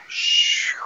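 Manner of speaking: long airy "shoo" for a downward move; whooshing noise. Shown as a demonstration. Dance teacher showing the move back down, whooshing noise. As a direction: imitate a swoosh with voiceless breath. Imitates the descending movement.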